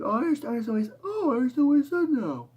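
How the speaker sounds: squeaky high excited voice; high cartoon voice of realization; high-pitched voice. Imitating friend understanding.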